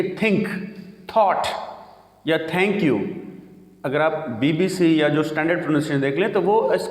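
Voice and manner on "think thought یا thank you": hard t in think, thought, thank you; wrong TH. Shown as a demonstration. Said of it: "ہمیں یہ نہیں کہنا چاہیے".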